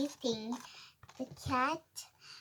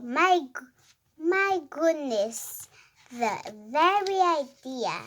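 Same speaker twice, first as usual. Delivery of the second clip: animated story reading; 6 dB louder than usual. Acted. Reader voicing a quarrelling cat and dog, animated read-aloud. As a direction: read it out clearly, pitch jumping up on woofs and meows.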